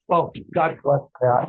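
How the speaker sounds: ordinary talk, nothing marked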